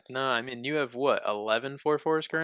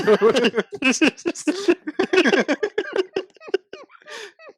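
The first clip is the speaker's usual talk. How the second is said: helpless laughter; 8 dB louder than usual. Real, not acted. Laughing fit after quote.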